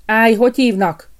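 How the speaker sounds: sharp guard's halt; gatekeeper's challenge. A narrator's reading. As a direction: stop him at the gate with a loud call. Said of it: "állította meg a kapus".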